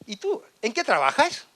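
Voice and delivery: high-pitched mimicked woman asking; put-on high questioning voice; imitated date's question. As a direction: lift the voice high, like a curious date. Imitates his date's fateful question.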